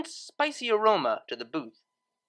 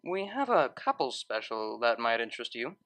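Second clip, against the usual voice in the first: sly; 7 semitones lower, 4 dB quieter than usual. A narrator's reading. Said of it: "he added slyly".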